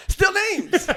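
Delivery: high, drawn-out jeer; joking heckle. Real, not acted. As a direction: heckle him playfully in a high, stretched voice. Heckling over dropped names.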